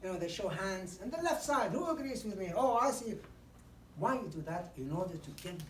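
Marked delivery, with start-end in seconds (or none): 1.0-2.5 higher and louder, energetic; engaging call to audience. Shown as a demonstration. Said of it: "Then quickly you say". 2.5-3.1 mock surprise. Shown as a demonstration. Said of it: "you say".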